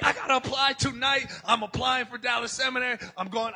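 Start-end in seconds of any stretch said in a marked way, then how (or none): 0.0-1.3 eager. Retold or imitated. Retelling his eager rush.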